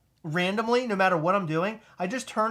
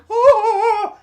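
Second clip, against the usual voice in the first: high, loud shocked gasp; a huge thrilled gasp; loud excited gasp; 14 dB louder than usual. Retold or imitated. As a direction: gasp hard at the news. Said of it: "And I'm like".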